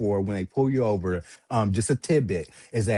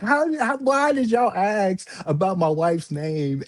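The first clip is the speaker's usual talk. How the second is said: high, pleading panic; panicked; 7 semitones higher, slower than usual. Retold or imitated. Man questioning police, high panicked pleading. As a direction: voice jumps up in alarm.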